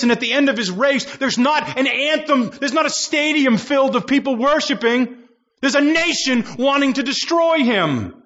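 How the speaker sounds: high, driving preacher build; rising sermon fervor, pitch high; preaching at full force. Acted. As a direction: preach it like the race's end is here.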